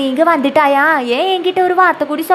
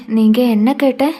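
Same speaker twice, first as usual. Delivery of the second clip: ordinary talk, nothing marked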